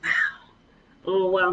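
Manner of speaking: ordinary talk, nothing marked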